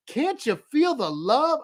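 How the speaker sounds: high, slow, sugary joking question; mock sweet mom. Mom teasing her son on the phone, mock sweet. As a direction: lay the love on thick.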